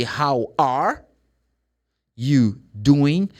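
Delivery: "are" fully sounded, then a pause before "you doing"; careful, unreduced "are"; full ARE. Shown as a demonstration. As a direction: break "How are / you doing" into separate words. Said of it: "they don't say".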